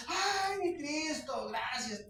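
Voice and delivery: squeaky high mock-reverent sigh; exaggerated high swoon of a fake worshipper; mock pious devotion. Mocking imagined reverent crowd.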